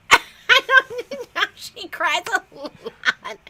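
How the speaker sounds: laughing. Real, not acted. Laughing about the daughter's crying.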